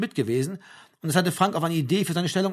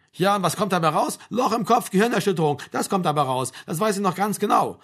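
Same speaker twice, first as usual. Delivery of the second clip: higher, louder, heated warning; scolding mother; 6 semitones higher, 4 dB louder than usual. A narrator's reading. Mother warns Frank about head injuries, heated. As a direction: hammer home the danger, lightly voiced mother.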